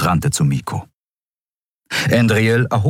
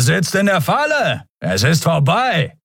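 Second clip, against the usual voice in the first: shouted threat; 9 semitones higher than usual. A narrator's reading. Attacker to the trapped crew, shouted taunt. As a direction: call out to cornered prey.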